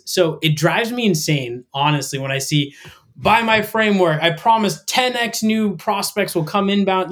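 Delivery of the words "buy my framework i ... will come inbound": high-pitched guru hype; mock sales pitch. Coach mimicking sales gurus who drive him insane, mock sales pitch. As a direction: parody a pushy promise, pitched up.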